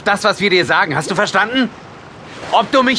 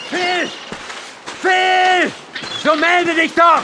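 calling out; 9 semitones higher, much slower than usual. Acted. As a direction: shout his name into the distance. Searching for missing boy in fog.

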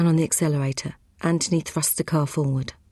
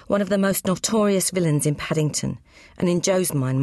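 usual speaking voice

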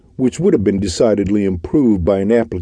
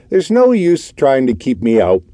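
stern; 6 semitones higher, 3 dB louder than usual. A narrator's reading. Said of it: "he continued sternly".

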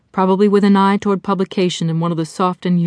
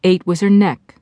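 ordinary delivery, nothing marked

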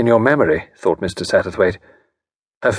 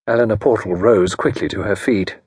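usual speaking voice

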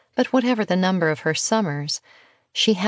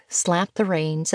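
plain reading voice

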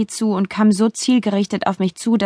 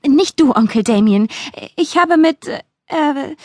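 higher, flustered correction; hasty backtracking; 6 semitones higher than usual. A narrator's reading. Niece correcting herself to her uncle, hasty and flustered. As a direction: backtrack in haste, then falter into fillers. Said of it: "fügte jedoch hastig hinzu".

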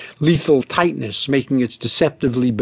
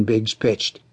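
usual speaking voice

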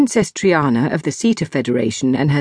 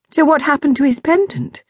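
Zoe pointed; 10 semitones higher than usual. Acted. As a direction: push back, puzzled and pointed. Zoe voiced.

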